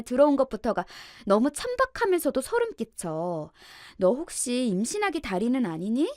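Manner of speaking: spiteful in-law's high, swooping jibes; loud, scornful sister-in-law. A narrator's reading. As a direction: needle her with loud, sneering suspicion. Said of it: "신우이가 큰 목소리로 이렇게 말하고".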